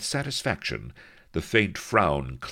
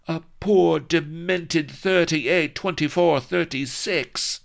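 much higher, loud, slow indignant cry; hot outrage; 8 dB louder than usual. Acted. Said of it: "cried Vanity hotly".

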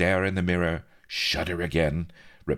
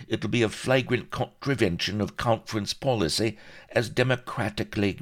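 high, emphatic Welsh union orator; impassioned Welsh pirate; 6 semitones higher than usual. A narrator's reading. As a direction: argue passionately like a Welsh shop steward. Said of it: "The little Welsh pirate was laying it on the line passionately".

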